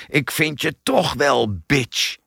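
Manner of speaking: angry distant yell, understated; furious shout. A narrator's reading. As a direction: drag out the threat in anger. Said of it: "gevolgd door een woedend".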